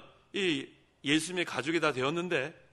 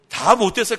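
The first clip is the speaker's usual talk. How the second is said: louder, higher punchline; emphatic shout; 5 semitones higher, 13 dB louder than usual. Acted. Pastor repeating his punchline to the congregation, loud and emphatic. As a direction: hammer the point home.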